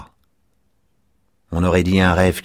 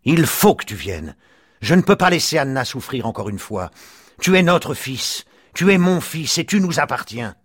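harder voice; 9 semitones higher than usual. A narrator's reading. Father insisting his son must come home, hard and firm. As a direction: lay down the law to his son. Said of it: "se fit plus dure".

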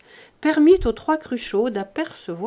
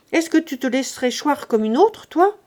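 mocking scold; 5 semitones higher, 5 dB louder than usual. Grandet mocks servant.

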